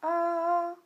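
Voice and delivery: "A" held long, high and loud; high level tone. Shown as a demonstration. Teacher for learners to repeat, "A" high and level. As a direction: sustain "A" on one high note. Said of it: "prononcer après moi".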